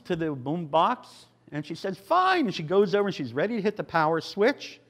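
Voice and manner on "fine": put-on defiant old lady. Old lady answers the gang member.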